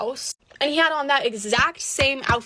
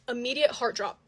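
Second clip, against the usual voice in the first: ordinary delivery, nothing marked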